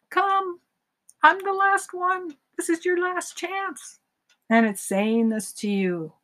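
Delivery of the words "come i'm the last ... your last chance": much higher, slow and coaxing; a chocolate donut luring you back, slowly; tempting donut voice. Acted. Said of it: "And the chocolate donut says".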